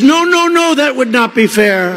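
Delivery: high protesting no no no; mock Macron protest. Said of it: "And he says".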